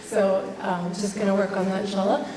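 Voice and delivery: hoarse, husky flu voice; rough voice from the flu; raspy whisper. Real, not acted. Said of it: "Excuse my voice, I have the flu".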